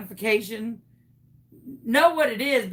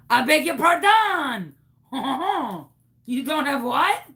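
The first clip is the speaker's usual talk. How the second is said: indignant mock French-Canadian accent with a fake laugh; 4 dB louder than usual. Caller caught without her accent, resuming fake Canadian accent. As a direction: snap back into the hammed-up French accent. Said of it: "What happened to your accent?".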